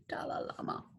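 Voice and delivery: hushed pun in a whisper. Pretend. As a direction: breathe the name out as a joke. Punning whisper on llama name.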